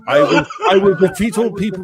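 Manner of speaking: high, loud mocking voice with a laugh; hammed-up mock heroine laughing through it; mocking Amber Heard. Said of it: "And what she's".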